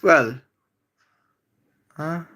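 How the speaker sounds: normal talking voice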